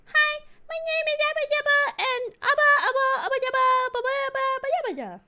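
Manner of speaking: very high, squeaky cutesy voice; squeaky anime girl. Retold or imitated. Streamer mocking high-pitched anime dubs, squeaky and high. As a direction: chirp it in a tiny anime-girl voice. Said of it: "one of those people being like".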